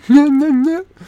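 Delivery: high squealing laugh. Real, not acted. Laughing at his own imitation of the staff. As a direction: burst out laughing.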